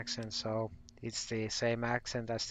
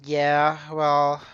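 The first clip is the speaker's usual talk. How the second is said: loud burst; 4 semitones higher, 15 dB louder than usual. Real, not acted. Reacting to dads line, laughing.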